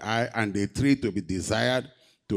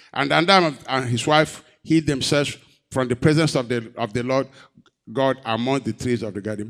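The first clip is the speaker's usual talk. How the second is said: ordinary talk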